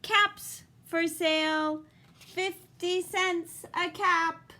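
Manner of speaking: slow, sing-song street cry; lightly voiced peddler's call. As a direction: hawk your caps slowly to the street. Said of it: "he called".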